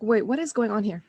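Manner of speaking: usual speaking voice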